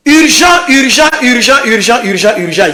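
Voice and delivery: urgent alarm call. Acted. Announcing breaking news.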